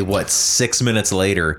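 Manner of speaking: normal talking voice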